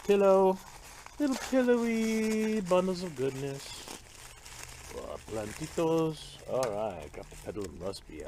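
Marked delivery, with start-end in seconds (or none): 0.1-6.4 cutesy gush. Real, not acted. Gushing over fuzzy plants.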